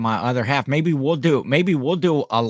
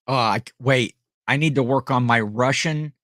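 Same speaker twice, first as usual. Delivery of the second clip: normal talking voice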